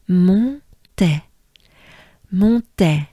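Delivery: Montais, nasal mon, clear TEH; French Montais. Shown as a demonstration. Teacher says Montais for students to copy. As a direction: model Montais cleanly. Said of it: "Good breath with me".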